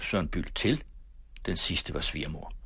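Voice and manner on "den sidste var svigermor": usual speaking voice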